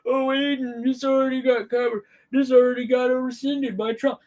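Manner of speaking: high-pitched, put-on complainer voice; mocking chat viewer. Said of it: "everybody out there in the chat that's probably about to go".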